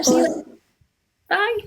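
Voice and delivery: usual speaking voice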